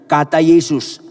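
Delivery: ordinary talk, nothing marked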